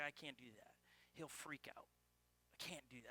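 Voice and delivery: soft and low, barely above a murmur; a hushed, worried mother saying no; mother's hushed refusal. Retold or imitated. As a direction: tell him no, gently and quietly.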